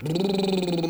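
lips buzzing like a plane engine; playful engine brrr; airplane noise. Acted. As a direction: buzz out a plane taking off. Imitates airplane.

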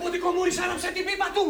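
high, urgent lookout's cry; urgent alarm. Acted. Lookout warns the family the police are coming, urgent cry. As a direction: call out the warning.

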